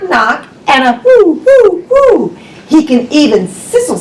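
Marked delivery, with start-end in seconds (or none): 1.5-2.4 high whooing. Pretend. Sound effect in picture book.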